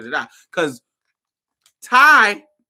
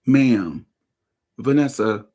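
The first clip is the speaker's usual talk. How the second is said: usual speaking voice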